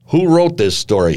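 mock yell, pitched well up; angry yell. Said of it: "he yelled".

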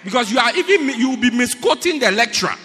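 loud, indignant pulpit rebuke; booming sermon reproach; full-force preaching. Real, not acted. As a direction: preach it at full volume, scolding the congregation.

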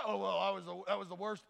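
low mock-glum mumble; low, deflated voice; acted dejection. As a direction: put yourself down gloomily. Discouraged kid doubts own performance.

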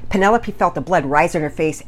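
plain reading voice

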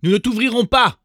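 high, loud refusal; shouting kids. A narrator's reading. Little goats shouting at the wolf to refuse. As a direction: yell it back through the door. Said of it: "crièrent-ils".

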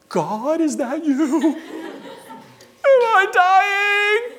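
high, wailing child's plea, hammed up; mock-terrified question to the heavens; frightened awe. Acted frightened child asking God.

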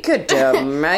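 put-on Australian mate; Australian accent. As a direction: have a playful go at Australian. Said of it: "read in your best Australian".

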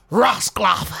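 mock Jamaican patois swear. Shown as a demonstration. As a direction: say it like a Jamaican patois speaker, loud. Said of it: "Can you say something in patois".

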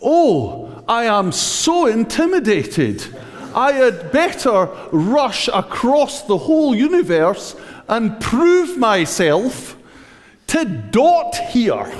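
high, hammed-up sarcastic fear, laughing through it; mock intimidated. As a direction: pretend to cower, dripping with sarcasm. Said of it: "God is going".

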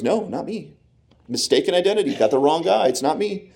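high, light-voiced brush-off; mock denial. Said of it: "Peter goes".

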